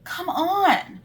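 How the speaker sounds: high-pitched, exasperated disbelief; high, drawn-out objection; incredulous protest. Real, not acted. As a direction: scoff at how unfair that is. Scoffing at the agency's perks.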